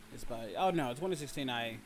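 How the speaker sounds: normal talking voice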